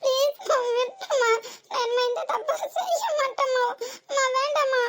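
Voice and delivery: high-pitched, whimpering begging; frightened pleading. Acted. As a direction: plead for the beating to stop. Begging not to be beaten. (பயந்து கெஞ்சும் குரல்)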